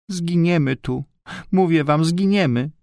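put-on high, tearful little-girl lament; płaczliwa rozpacz. A narrator's reading. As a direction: wail it lightly in a high voice, close to tears. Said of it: "W oczach Barbarki rozbłysły łzy". (płaczliwy, wysoki głos dziewczynki)

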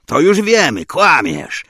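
loud officer cutting him down; angry accusation. Acted. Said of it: "komandir rozsierdził się na mnie".

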